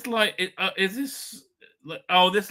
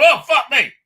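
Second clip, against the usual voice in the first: shocked; 8 semitones higher, 11 dB louder than usual. Retold or imitated. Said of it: "and then you see her, like".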